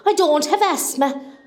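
normal talking voice

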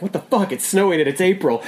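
high disbelieving outburst; half-laughing mock outrage; incredulous. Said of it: "we were both just like".

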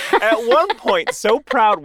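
a giggly, drawn-out restart; laughing restart. Real, not acted. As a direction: pick the story back up while laughing.

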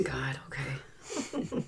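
soft whispered okay with a rise. Pretend. Co-host answering the dinosaur joke, whispered. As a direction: play along with a secret.

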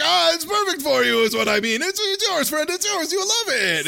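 high, wheedling huckster voice; over-sweet high seller pitch; sly salesman. Retold or imitated. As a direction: push the vase on him like a pushy vendor. Rashid pushing vase on Spike.

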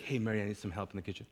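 soft, quick, low aside; the reasonable way to ask for help; lightly voiced gentle request to Mary; calm polite request. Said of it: "She could have easily like".

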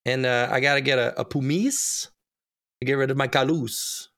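"pumice" as "poo-MEECE", "callus" as "ka-LOOS"; silly mispronunciation. Pretend. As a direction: put a fancy final stress on "pumice" and "callus". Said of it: "don't know why I'm doing that".

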